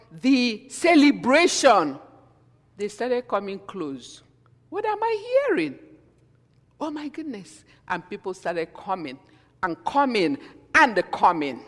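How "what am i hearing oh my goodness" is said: very high astonished cry, dropping to a breath; stunned onlooker hearing the tongues; amazed crowd. Acted. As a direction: gasp it out in wonder.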